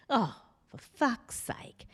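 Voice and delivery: whispered exasperated grumble; muttered swearing. Retold or imitated. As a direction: curse under your breath. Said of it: "I'm sorry about his swearing".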